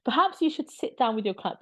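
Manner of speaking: high, sing-song condescension; patronizing. Retold or imitated. Mocking the lawyer's reply, high sing-song condescension. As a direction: talk down sweetly, like to a child. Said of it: "it's so patronizing".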